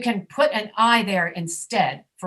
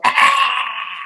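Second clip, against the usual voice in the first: loud, higher mock yell of refusal; acted child recoiling from food; loud "aah" of a child rejecting broccoli; child's disgusted cry; 4 semitones higher, 6 dB louder than usual. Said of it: "and they go".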